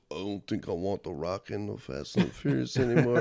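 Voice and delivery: low, subdued action-star mumble; low-pitched mock tough guy; Vin Diesel. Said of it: "Vin Diesel went up to Cena".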